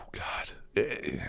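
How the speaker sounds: whispered under the breath; fed-up whisper to himself; exasperated whisper. Real, not acted. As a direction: breathe it out in disbelief. Exasperated muttering about island boys.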